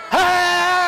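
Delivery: high, long, drawn-out yell; acted distress shout, pitched high; shouted cry for help. A narrator's reading. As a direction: call out for rescue across the woods. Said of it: "it shouted out".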